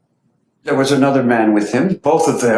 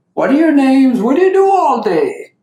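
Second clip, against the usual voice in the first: high, louder, bright-voiced kindly asking; friendly questions; 13 semitones higher, 4 dB louder than usual. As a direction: chat warmly to win the boys over. Baba chatting with boys.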